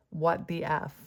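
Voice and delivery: ordinary talk, nothing marked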